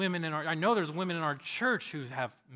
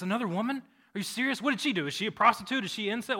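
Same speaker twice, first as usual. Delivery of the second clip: high-pitched, disbelieving questions; acted-out appalled scribe; incredulous questioning; 6 semitones higher, 4 dB louder, faster than usual. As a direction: pile up scandalized questions. Scribe incredulous at another woman.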